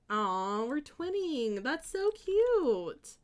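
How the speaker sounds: high, drawn-out coo; gushing coo. Real, not acted. Streamer gushing over twinning with a viewer, high drawn-out coo. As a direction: coo it, stretching the word cute.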